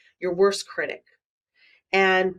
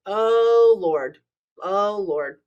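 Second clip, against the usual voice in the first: exasperated oh lord; 4 dB louder, much slower than usual. Real, not acted. Exasperated reaction to negative self-talk.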